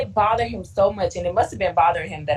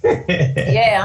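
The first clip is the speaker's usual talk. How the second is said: normal talking voice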